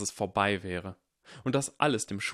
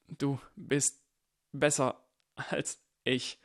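higher, slow and reluctant; forced admission; 5 semitones higher, slower than usual. Acted. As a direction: squeeze out the humiliating words. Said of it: "Jetzt sag ihm". (widerwillig, gepresst)

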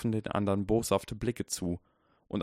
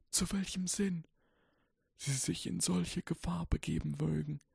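put-on softly reciting old wizard; 10 semitones higher, 4 dB quieter than usual. A narrator's reading. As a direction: murmur it like ancient verse. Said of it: "rezitierte der alte Zauberer sacht".

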